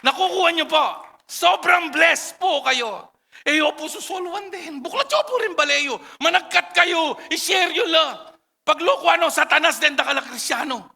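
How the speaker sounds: fervent revival preacher; full-force preaching. Acted. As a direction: preach at the top of your voice.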